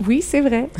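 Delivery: lightly imitated child's voice; a hint of a small, high voice; little voice. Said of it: "j'adore sa petite voix".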